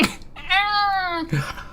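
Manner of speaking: high, soft creature laugh; imp giggle. Acted. Imp delights in the visitor, giggling high.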